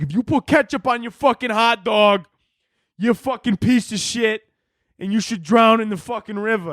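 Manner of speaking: hot dog zealot threatening ketchup users, mock furious; mock furious rant. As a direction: rant like a cartoonishly enraged local. Hardcore Chicagoans threatening ketchup users.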